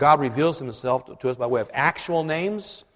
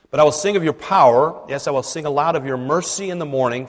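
loud, solemn psalm reading; scripture reading; 6 dB louder than usual. Acted. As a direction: proclaim the verses with feeling. Reads the psalm aloud.

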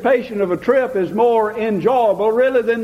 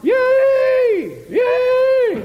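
joyful hollering. Retold or imitated. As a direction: holler Yay from far across the fields. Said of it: "somebody hollering".

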